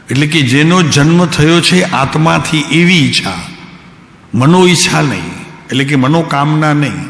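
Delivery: ordinary delivery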